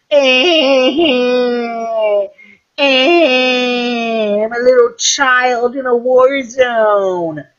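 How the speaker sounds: put-on high, far, dopey child voice with a dull eh; dazed little child. Mocking the kid in the war zone, high dopey child voice. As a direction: play a witless kid just standing in a war zone.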